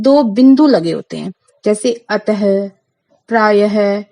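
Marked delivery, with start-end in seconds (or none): 2.1-4.0 अतः and प्रायः ending in a breathy "ह"; visarga ending sounded. Shown as a demonstration. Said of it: "जब मैं इसका उच्चारण कर रही हूँ". (अतः और प्रायः के अंत में हल्की ह ध्वनि)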